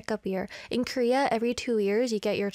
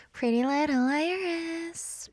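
slow drawn-out name; 4 semitones higher, slower than usual. Acted. Slow repeated host name.

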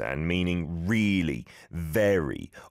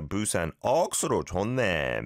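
Busan accent; much faster than usual. Shown as a demonstration. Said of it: "we gotta try to do it with a Busan accent".

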